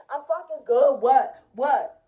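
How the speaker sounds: loud, defensive comeback; mock-aggressive reply, punched out; irritated retort. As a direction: act out snapping back loudly. Acting out cousin's irritated reply.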